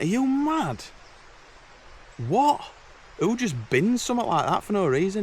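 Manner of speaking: high, incredulous mock outrage; high incredulous outburst at a binned sleeping bag; outraged disbelief. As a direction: replay your shock at the waste, high.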